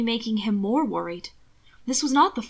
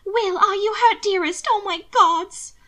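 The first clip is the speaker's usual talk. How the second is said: high, louder, anxious fretting; 11 semitones higher, 6 dB louder than usual. Acted. Mina fretting over hurt Will, high and anxious. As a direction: fuss over him in fright. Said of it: "Mina fretted".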